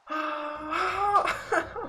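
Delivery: long, very high exasperated whine; wordless high moan of frustration; frustrated wail. Real, not acted. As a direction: let out a sustained high groan of disbelief. Upset at unreviewed fumble call.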